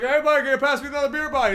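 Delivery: put-on fast, high-pitched Newfoundland accent; high mock Newfoundland voice; Newfie accent. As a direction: rattle it off like a Newfie. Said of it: "as well as that accent".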